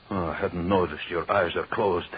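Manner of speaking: ordinary talk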